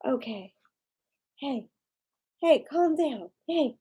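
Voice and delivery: soft, low calming of an upset dog; soft, low soothing voice for an anxious dog. Real, not acted. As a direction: hush him gently and softly. Hey, calm down.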